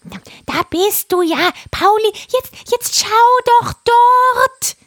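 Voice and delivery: high, loud parrot screech; croaking parrot. A narrator's reading. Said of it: "krächzte Pudigadidadi". (krächzende Papageienstimme)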